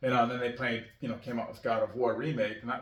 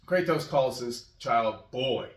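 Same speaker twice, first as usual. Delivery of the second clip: ordinary delivery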